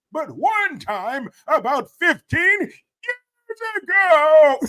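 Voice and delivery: put-on high, loud, rambling old politician addressing a crowd; politician impression. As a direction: shout out a speech to disaster victims, pitch way up. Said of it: "I was doing an impression of, this guy".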